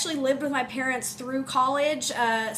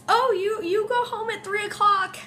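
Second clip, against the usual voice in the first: mocking people; 8 semitones higher than usual. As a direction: mimic people who dismiss teachers. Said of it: "people think that".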